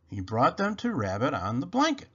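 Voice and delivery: usual speaking voice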